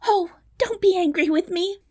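very high, frightened princess; pleading cry. Acted. Princess clasps hands before the dwarf, high pleading cry. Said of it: "cried the princess".

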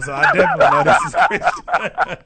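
high, loud laugh through the words; host laughing as he recognizes the caller. Real, not acted. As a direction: crack up recognizing a friend.

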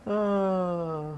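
real amused laugh. Real, not acted. Laughs at her own rant.

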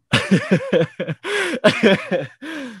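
high, real belly laugh; helpless laughter. Real, not acted. Host recalling the show's comeback, helpless laughter. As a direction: laugh so hard you cannot stop. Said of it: "I could not stop laughing".

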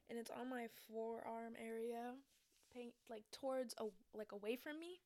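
ordinary talk

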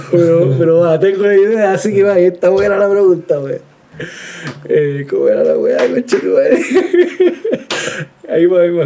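high and giggly, words loose; stoned. Real, not acted. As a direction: talk like you're stoned and laughing. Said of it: "estoy muy volado". (voz de volado)

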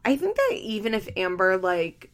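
ordinary talk, nothing marked

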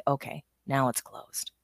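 soft whisper, half to herself; whispered self-reassurance; whispered to oneself. Retold or imitated. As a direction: whisper it like a quiet inner reassurance. Inner voice closing barrier.

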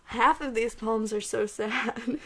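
slow, laughing at herself; amused self-mocking laugh. Real, not acted. Laughs at her sad poems.

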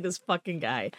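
soft exasperated under-the-breath remark; muttered aside. Retold or imitated. Annoyed at the actor following her, softly muttered. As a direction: grumble it to yourself quietly. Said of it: "I was like".

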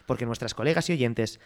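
hyped radio intro, building to the title; section announcer. Acted. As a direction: present the segment like a showman. (presentador anunciando la sección con entusiasmo)

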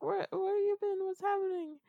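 high, fretful questions; worried friend. Retold or imitated. Dream friend asks where she's been, high and anxious. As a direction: pitch up and ask in a worried rush.

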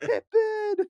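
high, laughing cry of the name; gleeful shout. Retold or imitated. Host echoing the Pippin joke with a laughing shout.

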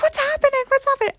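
very high mock-panicked squeal; panicked girlfriend. Girlfriend beside her screaming boyfriend, in imitated panic. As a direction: imitate a frightened girlfriend in the dark.

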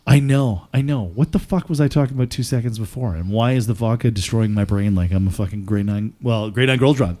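ordinary talk

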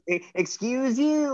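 high, slow, teasing excuse you; playful comeback. Retold or imitated. Heel wrestler playfully correcting a drunk fan, high and teasing. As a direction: toss the insult back with a playful lilt. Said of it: "she was playing with it".

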